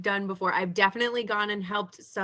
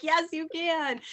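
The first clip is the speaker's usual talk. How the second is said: mock eager agreement; 9 semitones higher, slower than usual. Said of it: "I'm like".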